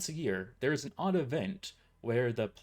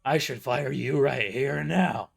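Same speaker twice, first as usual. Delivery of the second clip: put-on slow, much louder threat from an old boss; boss threatening; 2 semitones higher, 8 dB louder than usual. A narrator's reading. Boss threatening his late employee, slow and loud. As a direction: lean in slowly and threaten to fire.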